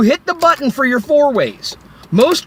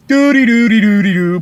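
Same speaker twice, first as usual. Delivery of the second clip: mocking carefree hum. Mocking oblivious drivers.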